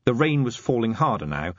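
usual speaking voice

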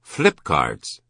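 abrupt remark; sudden exclamation. A narrator's reading. Shopkeeper suddenly remembering a childhood game, sudden blurt. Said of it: "Chilton had said suddenly".

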